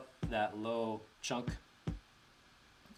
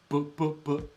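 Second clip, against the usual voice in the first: imitated boop sound; 3 semitones higher, 4 dB louder, slower than usual. Shown as a demonstration. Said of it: "I hear this kind of".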